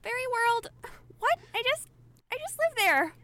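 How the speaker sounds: put-on high, tearful little girl voice; a lightly played weepy child; mock crying girl. Lost girl confused about realms, high tearful voice.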